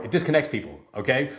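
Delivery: ordinary talk, nothing marked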